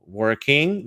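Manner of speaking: "working" with a strong American R; American accent. Shown as a demonstration. Teacher naming each version for students, American accent on "working". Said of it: "that would be American".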